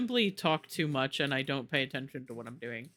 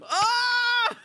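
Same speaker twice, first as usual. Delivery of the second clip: high piercing scream of fright; terrified scream; 6 dB louder than usual. Real, not acted. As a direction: scream in real terror. Scream at zombie child.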